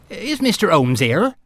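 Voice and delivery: a hint of West Country accent, cautious. A narrator's reading. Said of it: "in a rich West Country accent".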